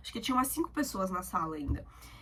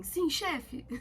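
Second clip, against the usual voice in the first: higher, crisp "yes boss" like a soldier; mock soldier salute; 7 semitones higher than usual. Student jokingly salutes the exam proctor, higher mock soldier reply. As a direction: snap a playful salute to the proctor. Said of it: "levantei a mão assim".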